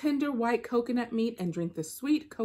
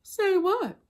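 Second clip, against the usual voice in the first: innocent deer; 12 semitones higher, 4 dB louder than usual. Acted.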